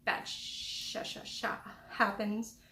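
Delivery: ordinary talk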